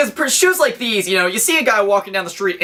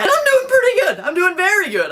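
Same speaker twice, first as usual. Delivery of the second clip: high giggly words through a laugh; 13 semitones higher than usual. Real, not acted. To his viewers, giggling through the words. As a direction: laugh it out, pitch way up.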